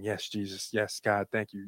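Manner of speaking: soft, low imitation of someone praying; murmured prayer. Pastor's wife praying over him, retold in a soft murmur. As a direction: quietly affirm the prayer under another's words. Retelling woman affirming a prayer.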